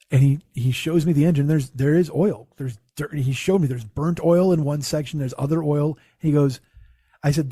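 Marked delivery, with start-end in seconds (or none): none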